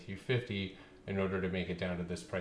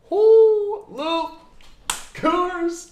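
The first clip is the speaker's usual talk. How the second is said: confused outcry; 14 dB louder, much slower than usual. Real, not acted. Confused by viewer's question.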